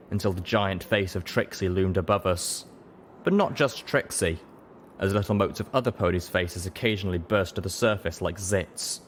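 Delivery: usual speaking voice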